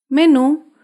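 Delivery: "Menú" as me-NÚ, final syllable stressed; careful teaching demo of "Menú", weight on the end; stress on last syllable. Shown as a demonstration. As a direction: lean into the NÚ of "Menú". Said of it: "se acentúa en la última sílaba".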